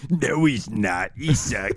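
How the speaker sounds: lightly voiced Matthew impression; a hint of Matthew's voice; imitating Matthew. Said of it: "sounded just like him".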